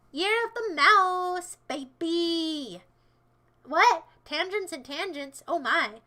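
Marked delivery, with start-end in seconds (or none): 0.1-2.8 loud, very slow stretched hype call; drawn-out cheer. Pretend. Streamer repeating the mouse year slogan, drawn-out cheer. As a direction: stretch each word into a cheer.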